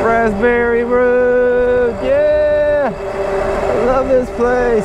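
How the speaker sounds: high, slow, drawn-out voice; high silly voice. Pretend.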